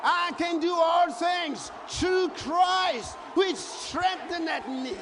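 shouted, sung preacher's cry; preaching at full force. Acted. Preacher shouting scripture to the church, full force.

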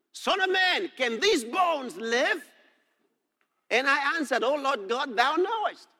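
high, declaimed Bible dialogue; dramatic scripture reading. Acted. Reads out God and prophet's exchange.

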